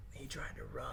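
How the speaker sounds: hushed whisper of a warning. Retold or imitated. Kid whispering to the newcomer, hushed whisper. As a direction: whisper it like a secret from the guards.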